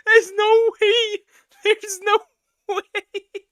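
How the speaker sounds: high, slow, giggling; barely getting words out; laughing disbelief. Real, not acted.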